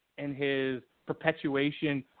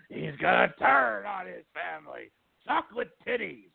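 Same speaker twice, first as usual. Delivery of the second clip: hammed-up wrestling promoter impression; Vince McMahon voice; 3 semitones higher than usual. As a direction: put on a promoter's voice, rising higher at the end. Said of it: "I don't know why I'm doing the Vince McMahon voice".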